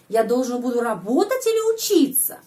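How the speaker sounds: или stressed and falling; или as the key word of the question. Shown as a demonstration. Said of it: "Atau itu или".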